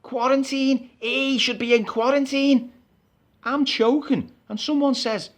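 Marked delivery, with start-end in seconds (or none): 0.0-2.7 mock crowd shouting. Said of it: "they're all shouting".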